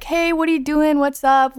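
breezy high chatty voice; fake casual caller. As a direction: act casual on a prank call. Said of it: "start talking casually".

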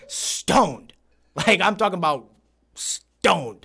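"Stoned" drawn out and set apart; pause, then lean hard on "stoned"; emphatic STONED. Real, not acted. Repeating the word for emphasis.

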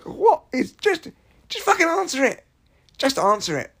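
high-pitched exasperated plea. Acted. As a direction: urge a dithering parent, at wit's end. Acted impatience with parents and phone.

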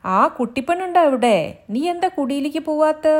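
high, loud village maid calling out; servant woman calling. Acted. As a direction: shout across the yard for the girl. Maid calls for the girl.

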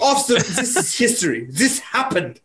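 put-on German accent. Protesting that it is history, in a mock German accent. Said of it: "Why am I still doing a German accent".

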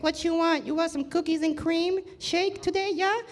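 high-pitched voice imitating a shop's head honcho; mama-san. Mama-san offering a customer a shake, high imitated voice. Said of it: "the head honcho of the place was like".

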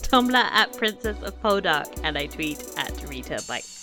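ordinary talk

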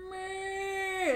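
high, whiny long-neck bleat; dying dinosaur moan. Retold or imitated. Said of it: "a fucking long neck going".

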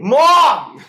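loud, high mock Boston yell; failed Boston accent, yelling for a parent; bad Boston accent. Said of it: "That's not a good Boston accent".

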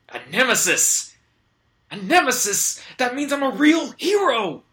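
high, loud excited cry; thrilled to have a nemesis; elated hero. Acted. Hero rejoices.

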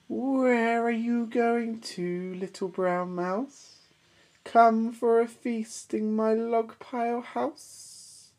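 high, slow, drawn-out snake voice; sly snake. Acted. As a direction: stretch it out temptingly. Snake invites mouse.

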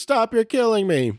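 squealing high while being tickled; high giggly plea, laughing through it. Pretend. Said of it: "And he goes".

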